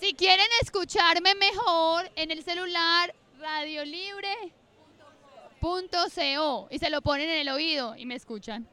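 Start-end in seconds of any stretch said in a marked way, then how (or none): 3.4-6.7 slow, drawn-out dictation, softer at first; dictado pausado. Real, not acted. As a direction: spell out the web address slowly, stretching each word. Dicta una dirección web al público. (dictado lento, alargando cada palabra)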